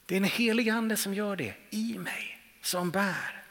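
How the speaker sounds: ordinary delivery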